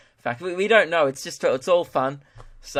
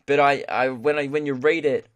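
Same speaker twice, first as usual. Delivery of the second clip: usual speaking voice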